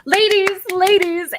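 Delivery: high, loud call to the women; urgent summons. Acted. Priestess rallies the ladies for a makeover, high urgent call.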